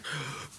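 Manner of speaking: sharp startled gasp; mock gasp. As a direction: gasp like you've been caught. Acting caught by police.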